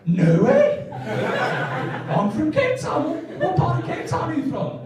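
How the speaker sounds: high, thrilled caller voice; excited client. Retold or imitated. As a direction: gush with delight, high. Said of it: "What does John say?".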